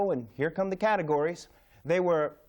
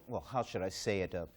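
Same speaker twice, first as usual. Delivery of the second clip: much lower and softer, tentative; delicate hesitation; 8 semitones lower, 9 dB quieter than usual. Acted. Commentator choosing a careful word about categories, delicate hesitation. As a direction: drop your voice and tiptoe to the word.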